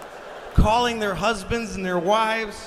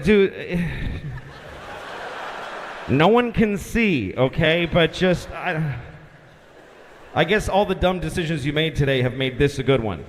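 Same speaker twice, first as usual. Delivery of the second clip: low, lightly voiced fed-up controller; a hint of a bored, done-for-the-day guy; weary controller; 7 semitones lower than usual. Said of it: "That's how he said it".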